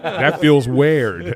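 "weird" stretched out as "wared"; mimicked mispronunciation. Teasing the host about "weird", mimicked mispronunciation. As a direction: drag out "weird" with an open air vowel.

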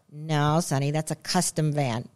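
a hint of a firm father; dad correcting. Dad correcting son over the truck book, low and soft. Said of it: "he'd go".

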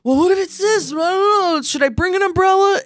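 high, sing-song scheming voice; mocking the show's producers; mock puppeteer voice. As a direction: pitch way up, toy with them. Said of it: "It's like".

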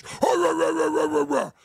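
lightly voiced blustering rant of "rah"; a mild mock bluster of nonsense syllables; Howard Stern's impression. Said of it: "Howard Stern goes".

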